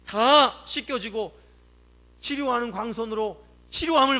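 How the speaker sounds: loud, high preaching voice; climbing sermon cry; fiery preaching. Acted. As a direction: preach it out with full fervour. Preacher at full force.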